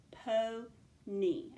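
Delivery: "Po-ny" split slowly into po and ny; two syllables. Shown as a demonstration. Teacher working out a spelling, "Po-ny" syllable by syllable. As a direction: say "Po-ny" with a break between syllables. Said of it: "I hear two syllables".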